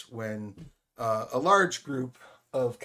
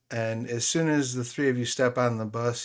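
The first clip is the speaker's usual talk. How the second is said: normal talking voice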